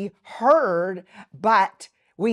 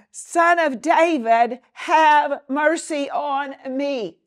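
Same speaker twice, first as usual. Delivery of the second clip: loud, higher pleading cry; crying out for mercy; 7 semitones higher, 7 dB louder, slower than usual. Retold or imitated. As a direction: beg at full voice, slow on have mercy. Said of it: "starts crying out for mercy".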